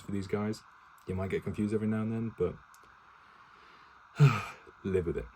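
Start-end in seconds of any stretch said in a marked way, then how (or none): 4.1-4.8 loud, falling sigh; exasperated sigh. Real, not acted. Reader defending his lack of voices, exasperated sigh. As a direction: let out a heavy sigh.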